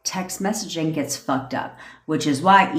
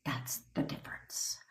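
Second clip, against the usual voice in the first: unvoiced whisper; 19 dB quieter than usual. Real, not acted. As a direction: breathe the words out. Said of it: "whispered aside".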